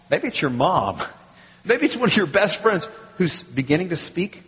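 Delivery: ordinary delivery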